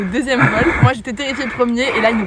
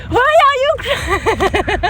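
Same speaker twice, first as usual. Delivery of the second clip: imitating instructor; 10 semitones higher, 3 dB louder, much slower than usual. Quoting instructor mid-flight.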